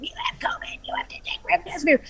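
faint distant voice from the back room; whispered like it's drifting in from another room; overheard from back room. Retold or imitated. Overheard nurse in the back room, faint and distant. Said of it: "you could hear in the back room".

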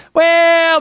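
a high, loud, stalling "Well"; mock evasive official. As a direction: play the squirming official who dodges the question. Officials' evasive reply, mocked.

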